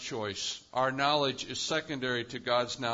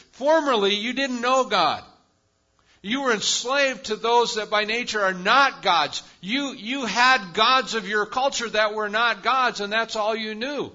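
loud, high preaching at full force; fervent preaching; 7 semitones higher, 8 dB louder than usual. Acted. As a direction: drive the point home to the congregation from the pulpit.

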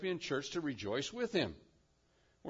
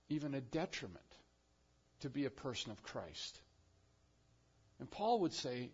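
ordinary talk

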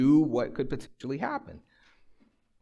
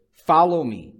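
loud, raised, firm order; forceful, commanding call; emphatic command; 4 semitones higher, 10 dB louder, slower than usual. Acted. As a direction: deliver it with an exclamation point. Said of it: "It's emphatic".

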